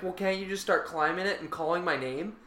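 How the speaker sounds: hint at her pleading on the phone; retold lost caller. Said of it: "And then she's like".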